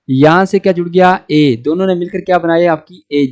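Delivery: ordinary talk, nothing marked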